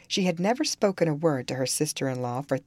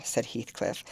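plain reading voice

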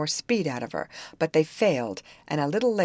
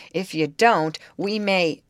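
louder, understated angry threat; 4 semitones higher, 6 dB louder, slower than usual. A narrator's reading. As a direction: threaten with a touch of anger. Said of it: "and his voice was angry".

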